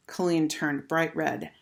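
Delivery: usual speaking voice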